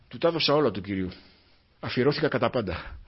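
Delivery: usual speaking voice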